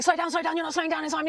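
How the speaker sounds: high, anxious urging of a frightened parent; panicked parent. Retold or imitated. Parent frightened in the car, panicked pleading. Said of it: "gonna be really frightened".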